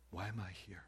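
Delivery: hushed, unvoiced self-question; whispered question. Real, not acted. Pastor musing aloud about his life, in a whisper. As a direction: whisper it to yourself.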